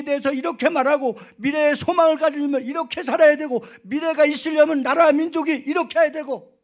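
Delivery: high, very loud sermon crescendo; impassioned preaching. Real, not acted. Pastor marvelling at an elder's words, fired-up preaching. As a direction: preach it at full force, pitch held high.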